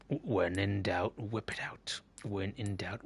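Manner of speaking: put-on husky whispered Australian accent advice. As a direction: whisper it slyly like a secret tip. Sly whispered advice to himself.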